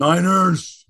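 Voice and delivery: hammed-up Robin Williams shout; Robin Williams impression. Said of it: "It's more Robin Williams".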